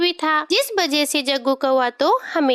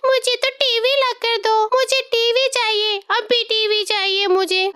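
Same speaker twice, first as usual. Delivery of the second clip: put-on young crow wailing for a TV, voice pitched up; crying loudly; 6 semitones higher than usual. Little crow sobbing to his mother for a TV, high wailing cry. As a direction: sob out the words like a child in a tantrum. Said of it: "जोर-जोर से रोने लगता है".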